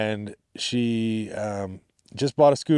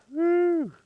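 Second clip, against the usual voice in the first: excited whoop. Real, not acted. Excited whoop at dog shadow.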